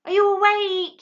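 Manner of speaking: high, loud call to wake his father; small owl shouting up to his sleeping father, loud. A narrator's reading. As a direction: owlet calling up loudly. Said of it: "he said loudly".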